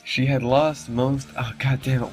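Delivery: usual speaking voice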